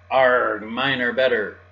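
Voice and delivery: loud, growled "arr" pirate shout; loud storybook pirate; pirate yell. A narrator's reading. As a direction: bellow it like a boasting pirate. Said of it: "The pirates are yelling".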